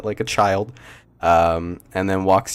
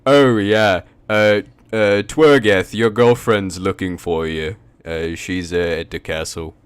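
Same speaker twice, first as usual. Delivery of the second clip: guard voice; 5 dB louder than usual. Acted. Guard NPC speaks.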